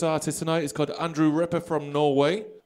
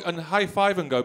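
normal talking voice

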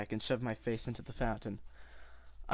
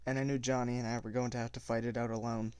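plain reading voice

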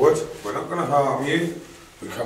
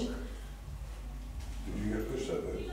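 whispering; 11 dB quieter, much slower than usual. Real, not acted. Ghost hunt, hushed question.

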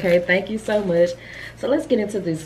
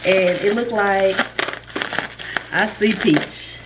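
ordinary delivery

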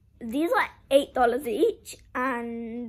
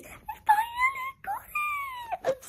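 high, laughing, breaking with joy; squealing excitement. Real, not acted. Girl to viewers about the brown top she finally got, squealing with joy. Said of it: "I'm so excited".

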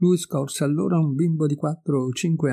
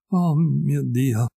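lento e inorridito, allungando l'oh; un grido d'orrore trattenuto, lento; esclamazione d'orrore; much slower than usual. A narrator's reading. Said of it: "esclamando di tanto in tanto". ("Oh mio Dio" lento e inorridito)

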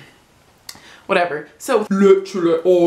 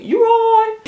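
squeaky mock reply; 5 dB louder than usual.

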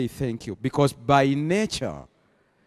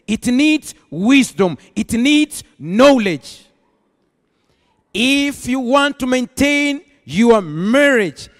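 raised, forceful preacher delivery; emphatic preaching; 7 semitones higher, 5 dB louder than usual. Acted. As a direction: drive each point home to the congregation.

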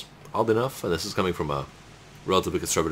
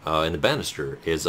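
ordinary talk

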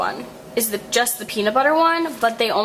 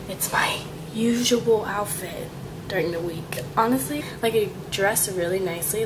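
whispering so the roommate sleeps; hushed whisper, trying not to wake anyone; 5 dB quieter than usual. Real, not acted. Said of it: "I'm whispering though because my roommate is sleeping".

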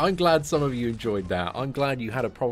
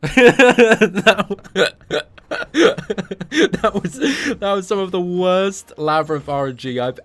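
loud, high, laughing through the words; cracking up at the worst luck; laughing in disbelief; 4 semitones higher, 10 dB louder, slower than usual. Real, not acted.

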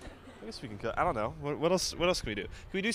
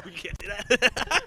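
laughing; 11 semitones higher, slower than usual. Real, not acted. Laughing at the Freemason joke.

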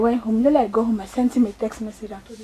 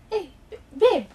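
high stammered startled babe; high startled stammer from the caught boyfriend; 9 semitones higher than usual. Retold or imitated.

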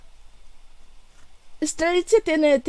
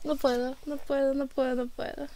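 very soft, low, overcome repetition; overwhelmed murmur; 3 semitones lower, 14 dB quieter than usual. Real, not acted. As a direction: murmur it weakly to yourself, overcome. Overcome after watching the trailer. (murmullo bajo, rebasada por la emoción)

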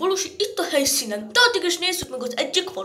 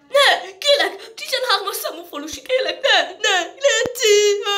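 high, whining pleas; desperate begging; 5 semitones higher, 4 dB louder than usual. Acted. Suspect begging villager number thirteen, desperate. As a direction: beg frantically, higher and breaking into wails. Suspect begs interrogator to stop.

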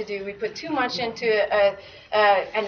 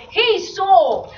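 Minnesota accent; 6 semitones higher, 3 dB louder than usual. Shown as a demonstration. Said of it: "a bit of a Minnesota accent".

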